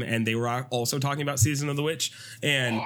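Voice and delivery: normal talking voice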